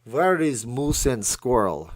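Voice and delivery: loud, slow cartoon Russian accent. Joking after From Russia with Love, Russian accent. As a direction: ham up a villainous Russian accent. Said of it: "You know, the best accent".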